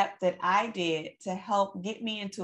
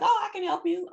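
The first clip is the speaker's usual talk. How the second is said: eager helper voice; 12 semitones higher, 4 dB louder than usual. Retold or imitated. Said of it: "I just went like".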